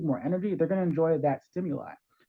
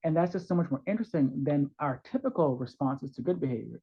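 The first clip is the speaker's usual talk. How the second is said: normal talking voice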